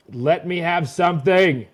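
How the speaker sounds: high, loud, drawn-out begging; exasperated plea. Real, not acted. Fan begging his losing team for anything, exasperated plea. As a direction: plead loudly, stretching the words.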